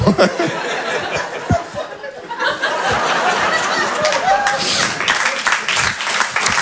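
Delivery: very high soft fake laugh; drawn-out squeaky polite laugh; courtesy laugh. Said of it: "rire de courtoisie".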